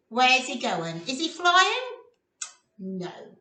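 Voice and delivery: ordinary delivery, nothing marked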